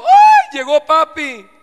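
put-on happy kids shouting on dad's arrival; excited child's cry. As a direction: squeal with joy as dad walks in the door. Children greeting dad with joy.

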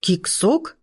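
astonished one-word guess, rising; gasped question. A narrator's reading. Brothers guessing the dreaded combat academy, gasping. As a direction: gasp it out as a question. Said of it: "ахнули братья".

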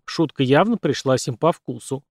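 ordinary delivery, nothing marked

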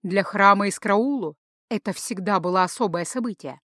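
plain reading voice

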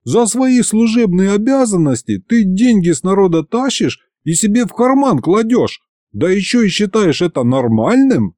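indignant. A narrator's reading. Boss accuses demon of pocketing bribes, indignant. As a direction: scold with rising outrage. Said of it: "возмутился я".

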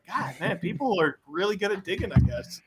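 muted retold reaction. Recalling a reaction to the leak, muted aside. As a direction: drop the volume for a private aside.

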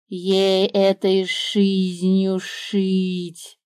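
very slow drawn-out snake voice; the snake answering the bird slyly; smug snake. A narrator's reading. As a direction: drawl it like a grinning serpent.

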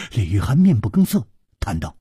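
plain reading voice

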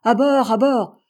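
panicked sailors yelling to get aboard; frantic frightened shout; terrified shouting. A narrator's reading. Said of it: "avec l'accent de la plus vive terreur".

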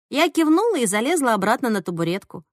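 ordinary delivery, nothing marked

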